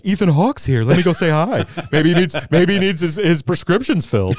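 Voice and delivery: co-host mocking his partner's celebrity hunting, eager high voice; Neil impression. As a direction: play an overexcited fan spotting a star. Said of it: "That's my Neil impression".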